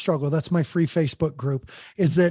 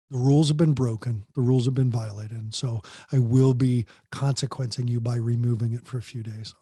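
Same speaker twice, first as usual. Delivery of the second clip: calm, loving; 5 semitones lower than usual. Shown as a demonstration. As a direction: take the phone with love, not fury. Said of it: "it's how you do it".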